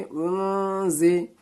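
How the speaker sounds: उनजी said slowly, the न hummed through the nose into जी; nasal ghunna on उनजी. Shown as a demonstration. Teacher showing students ghunna, slow nasal उनजी. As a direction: hold the nasal hum in उनजी. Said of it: "गुन्ना और इख्वा के साथ पढ़ेंगे".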